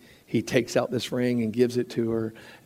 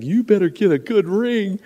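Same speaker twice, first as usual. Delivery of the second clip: teasing warning; 7 semitones higher than usual. Retold or imitated. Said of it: "I was like".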